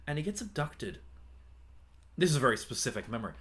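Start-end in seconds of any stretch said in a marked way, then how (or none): none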